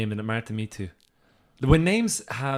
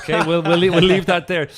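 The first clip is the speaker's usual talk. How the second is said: laughing while talking; 6 semitones higher, 11 dB louder than usual. Real, not acted. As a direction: laugh through the words. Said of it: "laughing after awkward joke".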